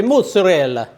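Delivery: hammed-up Italian "mozzarella", as moo-tsa-rel-la; Italian accent. Shown as a demonstration. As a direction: say "mozzarella" in a playful mock Italian voice. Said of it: "If you're Italian".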